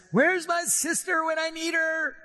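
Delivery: harried hostess fuming about her sister; mock frantic Martha; frazzled Martha. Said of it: "kind of freaking out".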